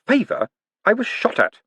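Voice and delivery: put-on high, outraged young man; blurting a defence; indignant protest. Moist protests.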